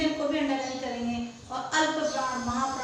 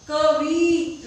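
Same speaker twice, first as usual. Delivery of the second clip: drawn-out demo; 7 dB louder, much slower than usual. Shown as a demonstration. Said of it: "कैसे बोलेंगे?".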